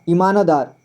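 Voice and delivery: "ईमानदार" with an audible "na" before दार; full न. Shown as a demonstration. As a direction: say "ईमानदार" as i-maa-na-daar. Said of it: "पूरी तरह से न का उच्चारण किया".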